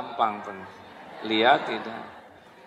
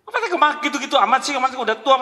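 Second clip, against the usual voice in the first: high, fast, shrill-sounding nag; nagging wife; 12 semitones higher, 4 dB louder, much faster than usual. Pretend. Wife nagging her old husband, high and fast. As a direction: rattle it off like an irritated wife.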